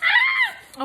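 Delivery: very high delighted shriek; short piercing squeal of glee. Real, not acted. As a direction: shriek with joy. Unboxing gift.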